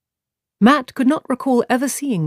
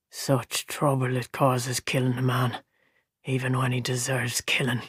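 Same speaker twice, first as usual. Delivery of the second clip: dark brooding; 4 semitones lower, 7 dB quieter than usual. A narrator's reading. Gleeman broods on killing.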